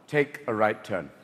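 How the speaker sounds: a prim British navigator, sniffy; bitchy English voice. Retold or imitated. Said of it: "it's a bitchy English voice".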